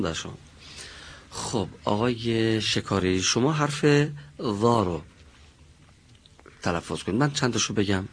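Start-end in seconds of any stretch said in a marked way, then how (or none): none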